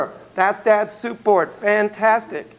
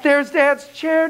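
excited; 9 semitones higher, much slower than usual. Acted. Echoing child, slow and high.

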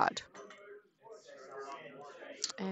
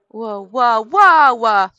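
loud sudden alarmed whoas; startled whoas; 15 dB louder, slower than usual. Real, not acted. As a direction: blurt out in real alarm. Panicking at unfamiliar Windows setup.

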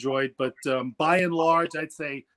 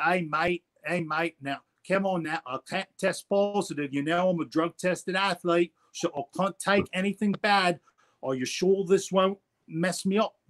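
put-on a light Australian accent, pitched a bit higher; a mild, pleading Australian accent; 3 semitones higher than usual. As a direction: imitate an easygoing Australian boxer asking the shop manager, in an Australian accent. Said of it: "that's my best Australian".

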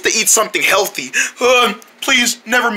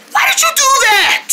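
angry shout. Acted. Scolding after the launch.

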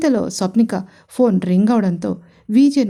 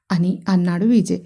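usual speaking voice

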